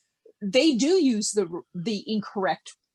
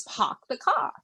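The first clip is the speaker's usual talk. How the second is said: teacher's R-less New England "park the car"; New England accent; 2 semitones higher than usual. Retold or imitated. Imitating third grade teacher, New England accent. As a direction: drop both Rs, New England style. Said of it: "but then it was".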